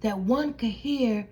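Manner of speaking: ordinary delivery, nothing marked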